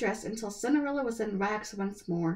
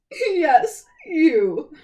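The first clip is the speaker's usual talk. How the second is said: louder scornful jeer; mocking; 6 semitones higher, 11 dB louder than usual. A narrator's reading. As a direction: sneer the word you at her. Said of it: "mocked the stepmother".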